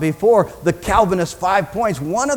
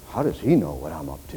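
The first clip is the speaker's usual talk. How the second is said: low hushed aside; dropped low, as if to yourself; astonished Nathanael, hushed; 13 semitones lower, 6 dB quieter than usual. Acted. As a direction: wonder it under your breath.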